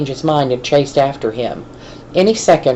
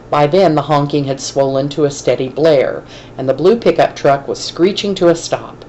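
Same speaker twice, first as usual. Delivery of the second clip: ordinary delivery, nothing marked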